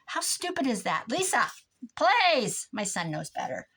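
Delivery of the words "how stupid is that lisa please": higher and louder, fed-up protest; exasperated. Real, not acted. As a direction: call her out like she's being ridiculous. Exasperated at friend's silly text.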